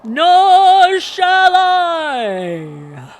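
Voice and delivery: long, loud, high ghostly wail; booming spectre cry, hugely stretched; guttural, horrific voice. A narrator's reading. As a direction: draw it out like a horrific spirit. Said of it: "said in a guttural and horrific voice".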